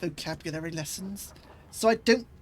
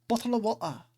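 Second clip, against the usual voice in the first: "bottle of water" glottal and quick; quick Cockney "bottle of water", no t's; rapid London "bottle of water" with glottal stops; London accent, faster; faster than usual. Shown as a demonstration. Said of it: "I may not have a London accent".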